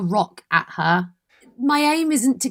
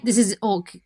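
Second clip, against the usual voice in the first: ordinary delivery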